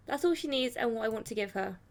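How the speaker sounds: usual speaking voice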